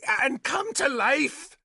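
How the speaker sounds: high, slow, combative retort; belligerent. A narrator's reading. Nimue answers Arthur's question, belligerently. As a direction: throw it back high and drawn out. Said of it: "Nimue said belligerently".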